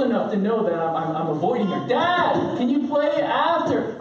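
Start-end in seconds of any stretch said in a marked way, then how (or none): 1.9-4.0 put-on high child's plea; persistent young daughter. Daughter asks avoiding dad to play after, high mock plea. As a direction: imitate a kid who won't give up.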